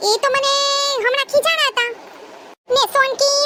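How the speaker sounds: put-on young bride puzzled by being called sister.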